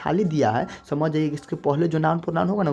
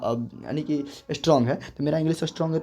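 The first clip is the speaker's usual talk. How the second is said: ordinary delivery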